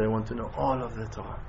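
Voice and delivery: low, very soft retold reply; quiet earnest plea. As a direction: say it low, almost to yourself. Retold student's reply, first take.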